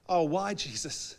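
mock-whiny, drawn-out protest; reluctant whine. Believer groaning at Jesus over being asked to yell, reluctant. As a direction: complain slowly, dragging it out.

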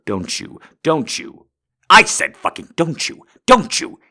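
building to a high furious yell; angry yell. Acted. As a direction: demand an answer, yelling. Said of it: "You love the aggressive voice".

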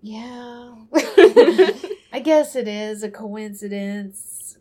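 slow, drawn-out grudging agreement; reluctant judge. Retold or imitated. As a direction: concede it slowly, with a chuckle.

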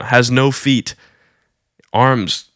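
ordinary talk, nothing marked

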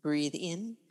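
usual speaking voice

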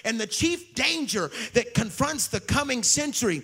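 loud, high preaching voice; a fiery revival preacher proclaiming; full-force preaching. Acted. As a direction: thunder it from the pulpit. Preaching opening quote.

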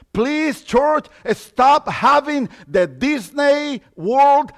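loud, slow, emphatic closing appeal; impassioned preaching. Acted. Pastor pleading with the church to drop Disney thinking, impassioned preaching. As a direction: plead with the church, then strike hard.

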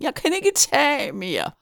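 high, slow wail; screamed despair. A narrator's reading. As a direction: wail it at breaking point. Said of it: "skreg Hyggen".

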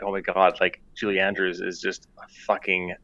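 ordinary delivery

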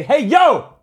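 high, loud hail; called-out greeting. Shown as a demonstration. Man showing friend how he says yo to drivers, calling out. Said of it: "when I say".

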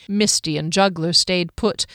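ordinary delivery, nothing marked

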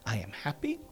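soft, quiet hint of an American accent; a hushed kid's American accent. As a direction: son murmuring the affirmation in a light American accent. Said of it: "he's got a, an American accent".

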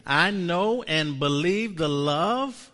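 a slow call-and-response confession; slow proclaimed confession. Shown as a demonstration. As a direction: proclaim it slowly for the room to repeat. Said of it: "Say this one with me".

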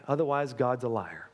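ordinary delivery

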